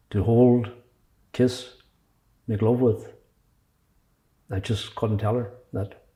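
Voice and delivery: measured recital with long pauses between phrases; poem reading. Acted. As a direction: read your poem in short, weighted phrases. Reads own poem about covid.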